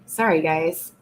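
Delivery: ordinary talk, nothing marked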